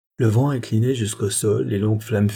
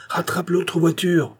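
urgent order; 8 semitones higher than usual. A narrator's reading. Urgent order during carriage chase.

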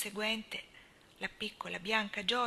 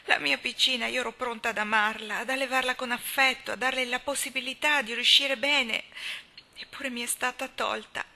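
loud, raised anguished protest; rebellious lament; 4 semitones higher, 7 dB louder than usual. A narrator's reading. Anna protesting to Marilla about her dead baby, loud and anguished. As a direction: pour out the unfairness. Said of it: "disse Anna ribellandosi".

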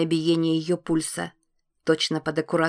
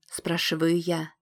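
ordinary delivery, nothing marked

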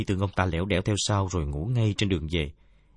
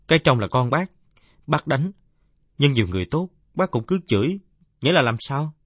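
lightly voiced kid, pitch raised; questioning youngster; 6 semitones higher, 6 dB louder than usual. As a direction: politely challenge an elder in a higher voice. (giọng đứa cháu hơi cao, chất vấn lễ phép)

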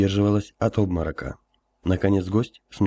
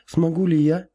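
nervous empress; 7 semitones higher than usual. Acted. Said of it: "справилась с волнением Алиса".

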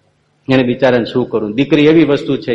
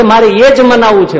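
higher, louder demand; firm insistence; 10 semitones higher, 8 dB louder than usual. Acted. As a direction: insist with full force. Maharaj firmly insists.

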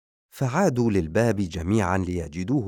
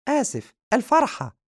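very high, loud squeaky cartoon cat; 4 dB louder than usual. The cat prompting the vampire, high squeaky cat. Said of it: "القط وهو يقول".

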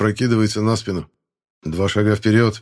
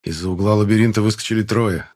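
ordinary delivery, nothing marked

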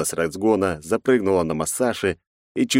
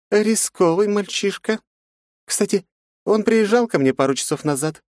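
femme fatale. Acted. Жанна кокетливо.

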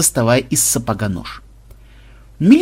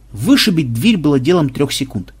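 plain reading voice

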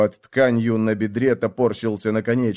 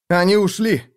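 higher, breathy relief on the out-breath; breathy exhale; 8 semitones higher, 3 dB louder than usual. Acted. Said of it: "выдыхаю я".

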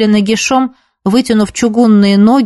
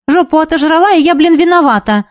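higher-pitched, aggrieved grumble; grumbling; 7 semitones higher than usual. A narrator's reading. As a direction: complain crossly at being blamed. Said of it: "буркнула Илья". (обиженно огрызаясь)

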